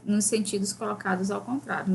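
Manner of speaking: usual speaking voice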